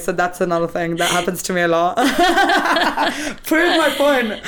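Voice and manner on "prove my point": much higher, louder through laughter; laughing. Real, not acted. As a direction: laugh the words out. Laughing at the coincidence.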